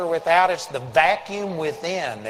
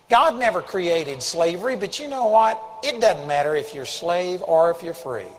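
ordinary talk, nothing marked

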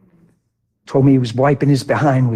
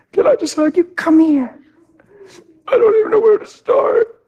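much higher, choked son's voice. Retold or imitated. Preacher voices his son on the porch, high and choked. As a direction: play the broken son at the door, high and tight. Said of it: "And I said".

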